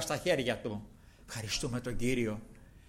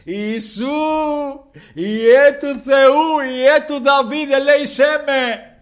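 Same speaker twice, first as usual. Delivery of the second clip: much higher, much louder, slow drawn-out pleading shout; blind man's cry; 12 semitones higher, 15 dB louder, much slower than usual. Retold or imitated. Blind Bartimaeus calling to Jesus, long loud pleading cry. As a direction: cry out for mercy across the crowd. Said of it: "άρχισε να φωνάζει".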